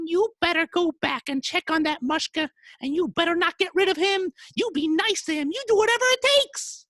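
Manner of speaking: very high falsetto orders; bossy wife. Acted. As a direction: lay down the law in a high wife voice. Wife orders husband back.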